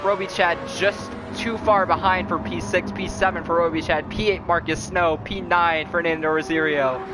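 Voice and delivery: winding down race call; play-by-play commentary. Acted. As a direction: read the results, easing off. Results after race.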